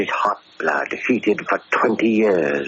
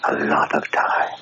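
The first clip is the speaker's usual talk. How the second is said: bitter whisper; 3 semitones lower than usual. Acted. Ex-convict barber muttering about his prison years, whispered. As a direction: breathe it to yourself, remembering.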